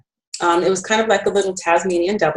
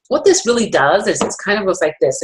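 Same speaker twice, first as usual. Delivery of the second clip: ordinary talk, nothing marked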